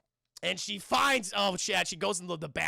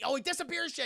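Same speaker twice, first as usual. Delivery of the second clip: ordinary talk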